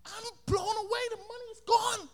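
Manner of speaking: high, far-off dismay; shocked kid. Retold or imitated. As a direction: gasp at the empty pocket. Boy panics.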